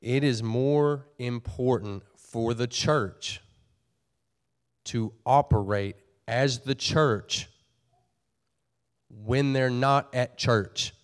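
slow, deliberate phrasing with long pauses; nice and slow. Real, not acted. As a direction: spell it out slowly, phrase by phrase, so nobody gets confused. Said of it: "I'm gonna say it nice and slow".

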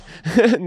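high, loud burst of laughing; burst of laughter. Real, not acted. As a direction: laugh out at his own joke. Joking about a rude request.

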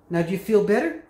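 ordinary talk

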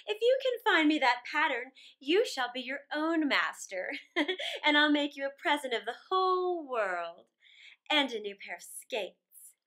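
laughing, commanding ice queen; Snow Queen laughing. Acted. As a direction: tempt the boy like a grand icy queen. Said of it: "The Snow Queen had said".

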